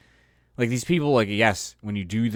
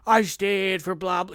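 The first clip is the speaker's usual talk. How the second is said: mock pompous actor; 8 semitones higher than usual.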